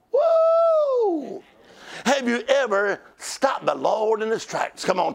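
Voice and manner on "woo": ecstatic whoop. Real, not acted. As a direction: let out a joyful preacher's whoop. Preacher's ecstatic outburst.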